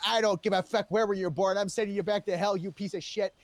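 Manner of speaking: loud, defiant retort; tough-guy comeback. Retold or imitated. A cop answers the terrorist, loud and angry. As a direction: spit it back at the villain. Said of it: "And I said".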